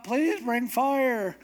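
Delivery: high, slow, drawn-out mock plea; stretched high begging; imitated crying out. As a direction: cry out like a desperate worshipper, hammed up. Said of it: "they're crying out".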